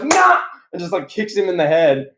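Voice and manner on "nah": high-pitched short mock yell; imitated attack yelp; Phil Brooks. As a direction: cry out while landing a kick. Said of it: "Phil Brooks goes".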